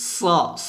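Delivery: স as a heavy, hissed S; dark, sustained hiss on স; thick S sound. Shown as a demonstration. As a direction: let the full স sound out first. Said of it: "এভাবে একটা শব্দ বের করবেন আগে".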